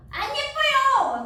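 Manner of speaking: drawn-out, high-pitched shout; mock boys' yell. Teacher imitating boys who deny she is pretty, yelling. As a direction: holler it slowly, imitating rowdy schoolboys. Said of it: "막 이렇게 소리 질러".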